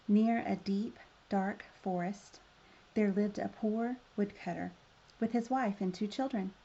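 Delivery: ordinary delivery, nothing marked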